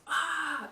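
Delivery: sudden high loud scream, imitating her brother; a brother's startled sleepwalking shriek, recreated; retold scream. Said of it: "just screams right in my face".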